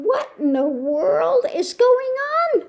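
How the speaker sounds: high-pitched incredulous question; a mock cry of bewilderment; exasperated disbelief. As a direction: sound baffled with the pitch way up. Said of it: "And I'm going".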